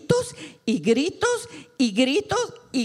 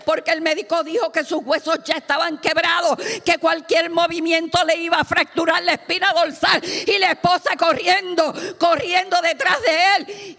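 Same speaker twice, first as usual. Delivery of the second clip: high, urgent storytelling to a congregation; fervent preaching; 8 semitones higher, 3 dB louder than usual. Real, not acted. Preacher tells of wife running behind him, fervent preaching. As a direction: keep the pitch raised and press onward.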